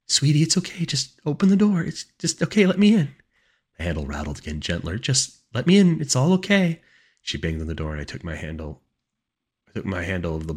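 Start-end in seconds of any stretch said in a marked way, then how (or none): 0.1-3.1 high, loud coaxing; high-pitched mother's plea. A narrator's reading. Mother coaxing through the door. 5.0-6.8 high, loud coaxing; high-pitched mother's plea. A narrator's reading. Mother coaxing through the door.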